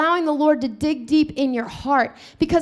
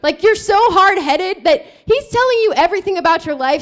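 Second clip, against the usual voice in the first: normal talking voice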